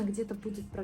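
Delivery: ordinary delivery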